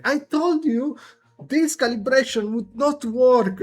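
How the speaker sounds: high, sharp told-you-so voice; Winter scolding. Acted. Winter scolding a crewmate over the calibration, high told-you-so voice. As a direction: needle the other engineer. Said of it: "Winter, like, saying".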